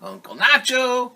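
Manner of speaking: high, loud call from outside. A narrator's reading. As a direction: call him from the doorway. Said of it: "came a voice at the door".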